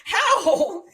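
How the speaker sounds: incredulous laugh on a high squeak; laughing disbelief. Retold or imitated. Disbelief at friends guessing twist.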